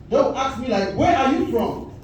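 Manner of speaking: normal talking voice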